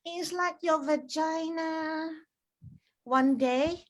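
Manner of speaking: slow, drawn-out mother voice; mom's explanation. Retold or imitated. Mom explaining the dip to her daughter, slow and ominous. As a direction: explain it slowly, like a solemn secret. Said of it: "this is how my mom explained it".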